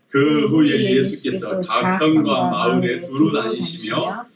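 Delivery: slow, measured scripture reading; reading in unison. Real, not acted. Preacher leads congregation in reading verse one, slow unison recitation. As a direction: read slowly so everyone keeps together. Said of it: "우리 다 같이 일 절 읽겠습니다".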